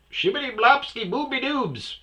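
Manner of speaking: very high, loud silly babble; hammed-up nonsense voice; mock gibberish. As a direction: parody incomprehensible mumbling. Mocking coworker's mumbled chatter.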